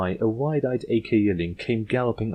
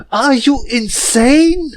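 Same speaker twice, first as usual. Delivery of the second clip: much higher, much louder, slow shout; shrieked disbelief; 12 dB louder, much slower than usual. Acted. Yearling shaking the princess, slow loud shriek. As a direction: scream it at her face.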